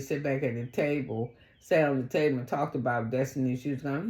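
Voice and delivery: normal talking voice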